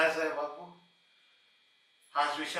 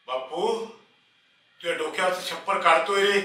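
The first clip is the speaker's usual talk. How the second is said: raised, louder mock announcement; reproachful imitation; 4 semitones higher, 6 dB louder than usual. Servant acts out his master warning of eviction, loud reproach. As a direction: voice the words he failed to say. Voices what friend should have asked.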